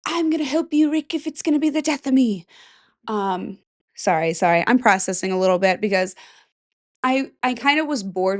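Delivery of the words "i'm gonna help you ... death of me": high, hammed-up dramatic plea; mock character line. Vlogger mocking a dramatic show line, high hammed-up plea. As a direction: ham up a TV character begging Rick.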